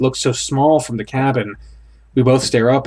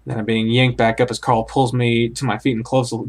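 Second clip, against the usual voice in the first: ordinary delivery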